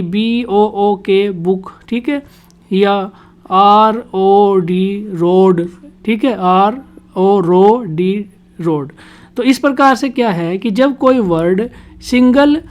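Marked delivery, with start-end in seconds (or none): 0.0-1.7 letter by letter, then blend into बुक; phonics spelling-out. Shown as a demonstration. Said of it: "आपको सिखाया जाता है". 3.5-5.7 slow separate letters, then रोड whole; phonics spelling-out. Shown as a demonstration. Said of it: "आपको सिखाया जाता है". 6.4-8.9 phonics blending. Shown as a demonstration. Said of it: "आपको सिखाया जाता है".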